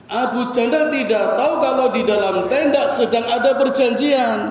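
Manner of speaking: ordinary talk, nothing marked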